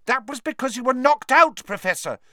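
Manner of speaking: high, earnest pleading. Acted. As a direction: plead sincerely. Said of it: "said Hermione earnestly".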